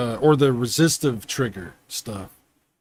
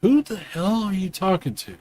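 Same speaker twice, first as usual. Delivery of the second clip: higher, put-on voice of a baffled cat; cat's voice; 6 semitones higher than usual. Owner voicing his confused cat, put-on higher voice. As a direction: voice the cat wondering who you're talking to. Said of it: "he's like".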